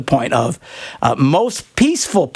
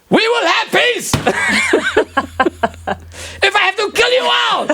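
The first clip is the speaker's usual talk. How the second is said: high, shouted mock tyrant with a laugh; mock warlord. Host teasing caller about her peace, mock tyrant shout. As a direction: bellow it like a crazed dictator. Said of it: "your version of peace is".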